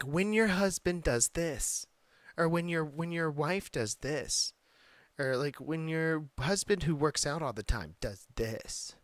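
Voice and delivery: high, slow mocking singsong voice. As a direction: parody a cutesy couples video. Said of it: "it's always like".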